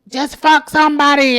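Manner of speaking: loud, blunt urging; urging friend. Retold or imitated. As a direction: imitate pushy friends, voice lifted in volume. Acting out friends urging her.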